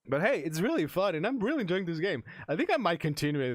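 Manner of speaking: cheerful burst of enthusiasm; excited. Real, not acted. Streamer cheerfully wrapping up.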